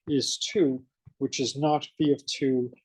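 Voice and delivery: ordinary talk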